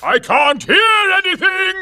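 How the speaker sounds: high shouted goad; egging them on. Acted.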